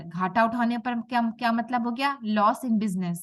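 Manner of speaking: ordinary delivery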